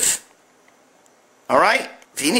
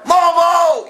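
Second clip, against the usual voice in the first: shouted name, much higher and louder; hollering the crew name out the car window; yell; 13 semitones higher, 5 dB louder than usual. Retold or imitated. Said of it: "You fucking yell".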